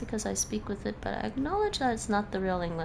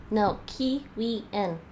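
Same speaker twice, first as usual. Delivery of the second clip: No, Kiwian drawn out slowly, every syllable of Kiwian spelled out; slow correction; much slower than usual. Shown as a demonstration. Correcting the chat on Kiwian, slow and deliberate. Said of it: "keep the stress on the first syllable".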